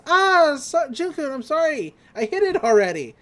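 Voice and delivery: put-on high, loud flustered girlish voice; sheepish apology. Girl apologizes to Ando mid-speech, high flustered voice. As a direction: blurt the apology, caught out.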